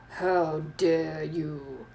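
low, very slow, quiet menace; icy, drawn-out professor's demand; Snape. Acted. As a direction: drawl it softly and deep, drawing out each word. Voicing Snape in read-aloud story.

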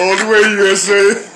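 high, loud laughing shout. Real, not acted. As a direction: crack up while shouting it. Echoing joke while laughing.

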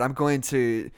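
normal talking voice

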